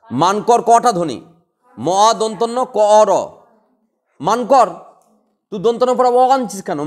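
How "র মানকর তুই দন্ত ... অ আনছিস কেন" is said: usual speaking voice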